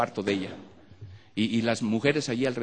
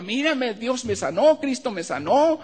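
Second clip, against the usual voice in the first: much higher, louder exclaiming; joyful cry; 13 semitones higher, 5 dB louder, slower than usual. Retold or imitated. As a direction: shout the healing with joy. Healed woman shouts to father. (grito de alegría, muy agudo)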